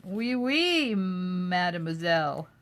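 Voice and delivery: playful mock French accent, mademoiselle slowed. Answering a chat request, a hammed-up French accent. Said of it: "French accent".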